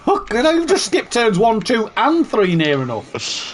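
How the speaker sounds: nagging backseat driver. Real, not acted. Backseat driver scolding teammate.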